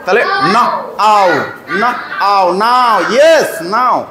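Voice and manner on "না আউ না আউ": না and আউ said apart, with pauses; sounding out. Shown as a demonstration. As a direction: split the word into না, then an "ow" glide আউ. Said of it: "W থাকলে একসঙ্গে কেমন উচ্চারণ বললাম".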